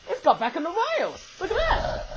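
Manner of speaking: high-pitched excited exclamation; excited surprise. Real, not acted. Toy reviewer sees train back on the rails, excited. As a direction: pitch leaping up in delight.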